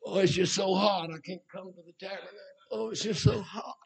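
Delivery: weak, put-on complainer's whimper; mock whining. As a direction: whine feebly, mocking a pampered churchgoer. Mocks complaining churchgoers.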